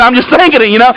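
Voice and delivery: normal talking voice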